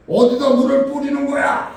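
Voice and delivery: high, loud indignant yell; angry outburst. Retold or imitated. Drenched passer-by yells up at whoever threw the water, angry shout. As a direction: explode at the culprit. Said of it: "벌컥 화를 낸 겁니다".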